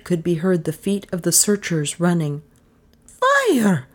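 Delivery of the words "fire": high-pitched cry of alarm; high narrated scream. A narrator's reading. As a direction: scream it high, without full volume. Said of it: "screamed Lizzie".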